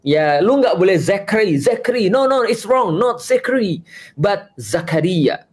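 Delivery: wag a finger at a wrong name; mock scolding. Mocking someone forcing the name Zakaria, mock scolding.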